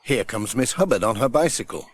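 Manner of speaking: ordinary delivery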